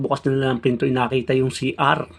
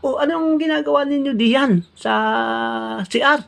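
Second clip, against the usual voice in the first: startled owner; 13 semitones higher than usual. Acted. Owner catching the two drinking from the toilet, high and shocked.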